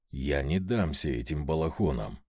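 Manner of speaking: low, defiant growl; inward growl. A narrator's reading. Master vows to himself to resist the robed men, inward growl. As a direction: growl it under control. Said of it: "прорычал я про себя".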